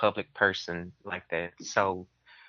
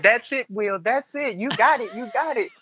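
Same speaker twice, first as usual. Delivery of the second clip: much higher, coaxing church lady praise; encouraging church lady; 12 semitones higher than usual. Retold or imitated. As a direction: cheer him on like a zealous church sister. Sister Mary cheering his tongues.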